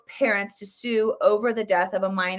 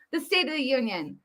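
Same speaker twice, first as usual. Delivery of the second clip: ordinary talk, nothing marked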